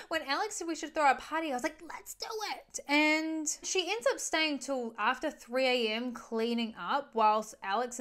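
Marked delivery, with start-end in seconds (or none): 0.1-2.8 breathless, fast, whispered Gigi voice; giddy Gigi. Retold or imitated. Gigi answering guests, giddy.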